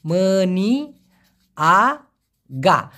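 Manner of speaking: মেনিয়াগা drawn out very slowly, each syllable clear; slow teaching demo of মেনিয়াগা; careful slow pronunciation. Shown as a demonstration. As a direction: spell out মেনিয়াগা slowly for learners. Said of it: "খুব খেয়াল করে দেখুন".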